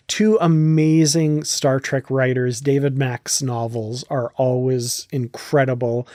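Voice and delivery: usual speaking voice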